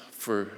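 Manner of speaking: a long weary sigh; heavy sigh. Real, not acted. Preacher sighs before telling the pilgrimage story, a heavy sigh. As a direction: let a tired sigh out before speaking. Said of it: "I'm sighing".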